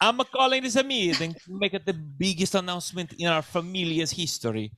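hammed-up Italian accent, stretching the key words; over-the-top Italian patriarch accent; cartoonish Italian accent. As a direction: announce it in a broad fake Italian accent. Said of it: "speaks with an accent that".

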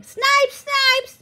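high, loud jeering shouts; yelling boys. A narrator's reading. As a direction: yell it like boys taunting in a schoolyard. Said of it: "All the boys were pointing and yelling".

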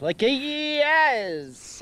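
yes high and very slow; peppered final yes. Shown as a demonstration. Host shows the friend how to punch up the last yes. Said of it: "put a little pepper on the end".

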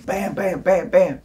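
high rapid-fire punch sounds; imitated punch noises. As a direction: punctuate each mimed blow with a punch noise.

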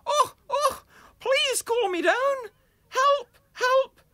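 very high, loud squealing cries for help; a tiny creature yelping in panic; panicked badger. Badger runs around burning.

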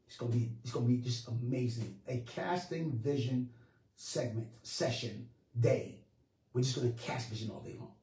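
low, soft, musing delivery; hushed preaching. Real, not acted. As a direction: drop right down after the fire.

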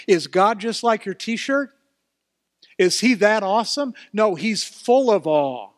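loud, rising preacher voice; fervent pulpit delivery; full-force preaching. Real, not acted. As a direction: preach it, building in volume.

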